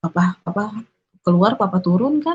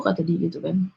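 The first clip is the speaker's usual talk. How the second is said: ordinary talk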